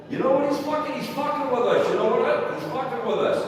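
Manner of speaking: high loud accusing cop; furious agent accusing; angry cop. Retold or imitated. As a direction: snap it at the suspect. Agent angrily accusing the guy.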